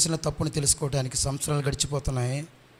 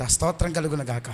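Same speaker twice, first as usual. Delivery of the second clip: ordinary talk, nothing marked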